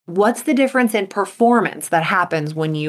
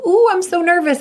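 mock nervous ooh; 10 semitones higher, 3 dB louder than usual. Shown as a demonstration. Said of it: "experiences of going".

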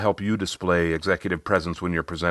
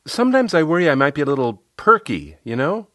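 higher, louder voice of a coaching client; a client airing a small worry, pitched up; Riley; 8 semitones higher, 5 dB louder than usual. Retold or imitated. Client confiding in her coach about seeming perky, higher voice. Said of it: "She said".

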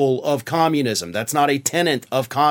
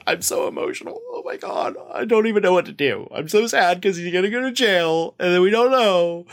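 high, breathless, mock-weepy fan gushing; hammed-up sobbing devotee; mock weepy Greene; 3 semitones higher than usual. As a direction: parody her tearful gushing over Trump going to jail. Mocking Greene's emotional interview.

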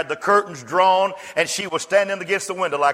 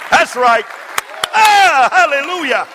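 much higher and louder shouted preaching; preaching at full force; 13 semitones higher, 7 dB louder than usual. Acted. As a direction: shout it to the whole church, full fire. Preacher erupts after the child story.

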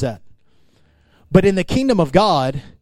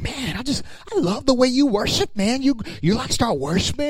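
high, hushed mock gushing; gushing admirer; 8 semitones higher, 3 dB quieter than usual. Admirer complimenting someone's worship, gushing. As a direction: gush in a soft, high put-on voice. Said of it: "someone comes up to you and like".

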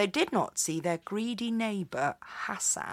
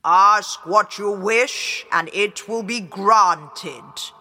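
loud, slow booming voice; booming genie; 6 dB louder, slower than usual. A narrator's reading. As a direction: boom it out like a giant genie. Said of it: "The djinn boomed".

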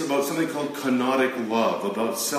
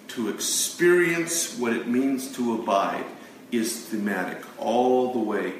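ordinary talk, nothing marked

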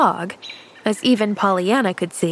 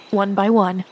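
plain reading voice

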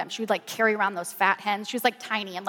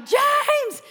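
high-pitched call for help; frantic mock yell to a husband; retold yelling; 3 dB louder than usual. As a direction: re-enact yelling his name, drawn out high. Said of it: "I'm yelling at James".

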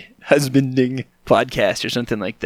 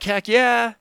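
excited; 10 semitones higher, 3 dB louder than usual. Retold or imitated. Excited at finding parking spot.

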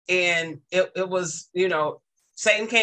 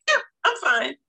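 put-on young boy brushing it off, higher; a hint of a kid shrugging it off, higher; imitating the student; 7 semitones higher than usual. Teacher voicing the student who claims he is fine, higher.

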